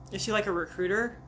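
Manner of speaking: ordinary delivery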